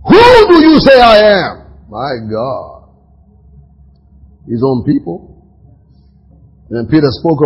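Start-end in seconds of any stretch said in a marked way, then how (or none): 0.1-1.5 higher, louder, ringing question; proclaimed question. Retold or imitated. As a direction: call it out to the disciples. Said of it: "then he said unto them".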